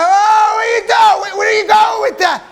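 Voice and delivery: high, loud, fast shout; outraged yell. Retold or imitated. Comic shouting at the DJ sweeping the money, high and loud. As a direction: yell at the DJ in disbelief.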